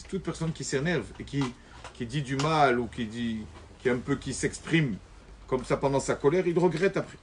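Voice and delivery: ordinary delivery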